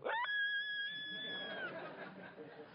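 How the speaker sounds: high, quiet drawn-out squeal; mock kid reaction. Preacher mimics a kid seeing the hand, high drawn-out squeal. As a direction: imitate a kid's high squeal. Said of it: "see a kid in the front row go".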